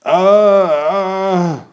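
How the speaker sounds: high, loud short screams; startled scream. Acted. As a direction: scream on impact. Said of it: "We screamed".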